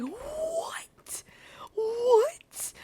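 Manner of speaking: stunned disbelief, high; shocked what. Retold or imitated. The desk lady hearing of the attack, high shocked disbelief. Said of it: "And then she's like".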